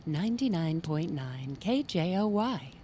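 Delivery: slow, smooth station ID; old radio voiceover voice. Shown as a demonstration. Host showing her guest her old radio station voice, smooth voiceover. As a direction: read it as a measured radio jingle tag. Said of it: "I'll do my old radio voiceover voice".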